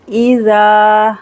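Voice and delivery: इज़ा with the alif held two seconds; stretched alif. Shown as a demonstration. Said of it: "अलिफ को पढ़ेंगे, दो second के खींचना है". (इज़ा में अलिफ दो सेकंड खींचें)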